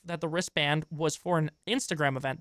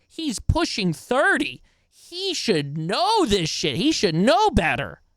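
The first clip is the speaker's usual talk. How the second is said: each word slowed and pressed, a touch louder by the end; slow emphatic scolding; 4 semitones higher, 4 dB louder, slower than usual. Real, not acted. Streamer scolding the apologizer to chat, slow and emphatic. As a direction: lay it down slowly like a lecture.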